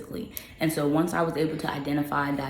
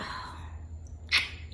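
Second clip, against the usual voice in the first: long breathy sigh; 9 dB quieter than usual. Real, not acted. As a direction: let out a long, airy sigh. Pauses after listing book tasks.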